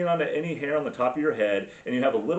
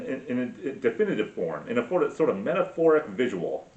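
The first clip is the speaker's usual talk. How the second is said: normal talking voice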